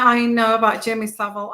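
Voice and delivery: ordinary talk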